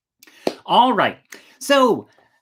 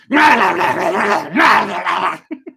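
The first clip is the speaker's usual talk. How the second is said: loud snarling wolf growl; 5 semitones higher, 9 dB louder than usual. Acted. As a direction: lunge and snarl like a beast. Wolf leaps at the princess.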